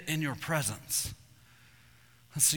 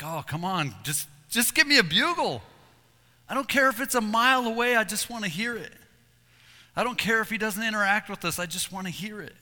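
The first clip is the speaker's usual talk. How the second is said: raised, pleading plea for a bugle; 7 semitones higher, 4 dB louder than usual. Retold or imitated. Hunter on the mountain begging for a bull, pleading. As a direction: beg the mountain to answer.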